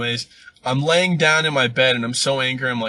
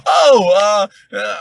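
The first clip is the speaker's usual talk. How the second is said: mock awe; 8 semitones higher, 9 dB louder, slower than usual. Mock amazement at tree rings.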